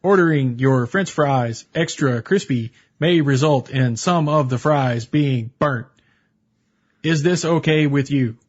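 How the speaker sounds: slow, stone-faced formal warning; deadpan robotic cashier; stiff cashier impression. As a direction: deliver it like a polite robot, slowly. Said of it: "and he says".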